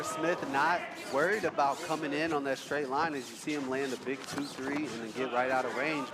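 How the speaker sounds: ordinary delivery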